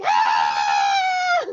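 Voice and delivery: loud scream of terror; sudden full-voiced shriek; re-enacted scream. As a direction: scream as the elevator drops. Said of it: "and I was like".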